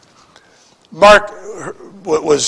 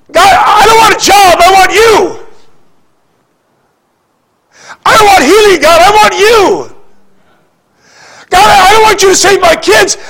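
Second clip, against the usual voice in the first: 1 fervent prayer; 6 semitones higher than usual. Shown as a demonstration. Voiced prayer to God.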